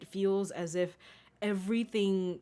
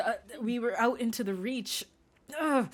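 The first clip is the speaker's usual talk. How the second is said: usual speaking voice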